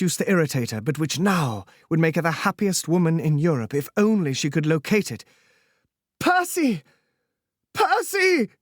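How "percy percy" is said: much higher, shrieking out a name; frantic high call across the beach; hysterical shriek. Acted. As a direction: scream for him in desperate hope. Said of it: "she shrieked hysterically".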